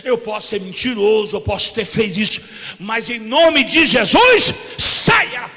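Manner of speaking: commanding exorcism shout. Retold or imitated. Preacher shouting at the demon to leave his sister. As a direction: command the demon out with full force.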